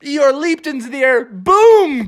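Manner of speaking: mock sportscaster narrating the throw; excited play-by-play. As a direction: call the play like a hyped announcer.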